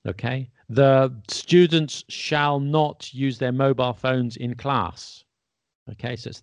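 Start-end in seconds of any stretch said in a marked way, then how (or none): 0.7-5.2 slow formal order, weight on "shall not"; deliberate, raised stress on "shall not"; emphatic command. Shown as a demonstration. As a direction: lay down the rule slowly and firmly. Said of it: "because it's an emphatic command".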